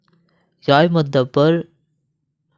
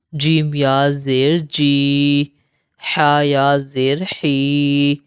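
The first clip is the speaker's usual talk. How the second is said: slow, chanted letter-by-letter spelling; Qaida drill recitation; much slower than usual. Shown as a demonstration. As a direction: spell each syllable out, then join and stop. (قاعدہ کی ہجے والی قراءت)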